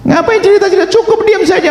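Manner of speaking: the repeat cried out high in exasperation; exasperated shout. Real, not acted. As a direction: shout it in disbelief at the listeners. Repeated much higher and louder.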